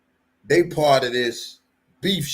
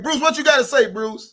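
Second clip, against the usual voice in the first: quick, loud and high call-out; 11 semitones higher, 6 dB louder than usual. Real, not acted. Vlogger cueing Bruce to speak, calling out loud. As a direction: throw it over to the next speaker, loudly.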